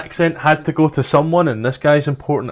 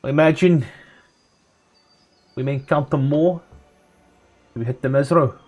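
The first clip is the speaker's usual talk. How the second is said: put-on South African accent. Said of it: "the New Zealand and the South African accent".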